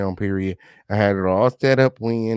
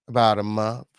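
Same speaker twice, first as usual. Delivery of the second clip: normal talking voice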